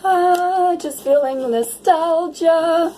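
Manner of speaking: high, slow, drawn-out nostalgic sigh; nostalgic swooning. Acted. Reader acting out the urge to swing in your energy, nostalgic swoon. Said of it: "Like, I'm like".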